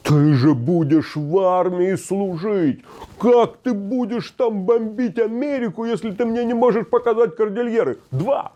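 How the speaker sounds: hammed-up outrage at a pupil who can't find the mountains; angry teacher. Teacher scolding a pupil at the map, mock outraged. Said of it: "Хрясь!".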